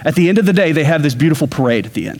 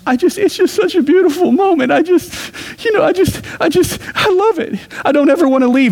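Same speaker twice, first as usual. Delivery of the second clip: high, breathless voice of an overwhelmed daughter; imitating tearful daughter; 12 semitones higher than usual. Pastor imitates his daughter crying at the Disney parade, high and gushing. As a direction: pitch way up, gushing like a teenager moved to tears. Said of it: "she says".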